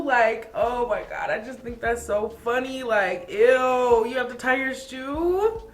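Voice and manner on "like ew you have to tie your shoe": a touch of playful ew; lightly mocking disgust. Real, not acted. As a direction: tease it as if grossed out. Mocking shoe-tying ick.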